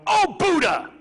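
a hammed-up cry of pain; mock curse. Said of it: "I didn't hear them go".

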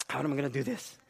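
ordinary delivery

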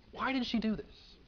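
high, hushed indignant grumble; indignant mumbling. Acted. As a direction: grumble it softly to yourself, affronted. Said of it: "mumbling to themselves indignantly".

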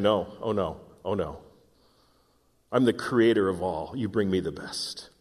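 low, soft, firm voice of God; God's quiet refusal. Acted. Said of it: "And God is saying".

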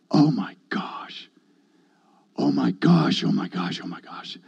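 hushed, fading to almost nothing; awed whisper. Retold or imitated. Man reliving being lit on fire, awed whisper. Stunned reaction after the fire stops.

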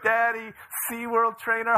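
high imitation of a tickled boy; mock-gleeful child's taunt; laughing son. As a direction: parrot the joke like an amused kid. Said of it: "he starts laughing".

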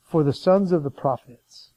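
slow, measured Bible reading; scripture reading. Acted. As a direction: read the passage slowly and solemnly. Reads scripture passage aloud.

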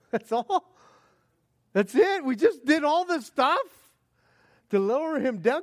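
disbelieving friends. Retold or imitated.

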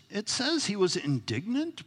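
ordinary talk

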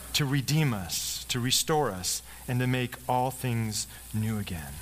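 slow, solemn preaching cadence. Acted. Pastor to his congregation, slow solemn sermon close. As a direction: draw out the close of the sermon, slowly.

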